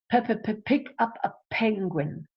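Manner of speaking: stuttered advert slogan on "pick"; repeated P sound. Shown as a demonstration. Teacher quotes advertising to show alliteration, stuttering the "P" in "pick". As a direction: pop "P, p, p" quickly before "pick". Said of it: "You've got your P sound there".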